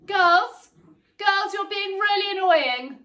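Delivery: loud, high call across the house. Real, not acted. Mother shouting at her squabbling girls, loud and high.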